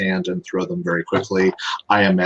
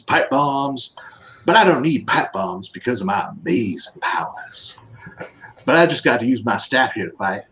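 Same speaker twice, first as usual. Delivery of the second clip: put-on Louisiana accent. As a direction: read it as a boastful Louisiana bayou ninja. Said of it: "you have to read it in the accent".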